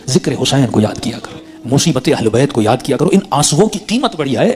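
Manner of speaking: ordinary delivery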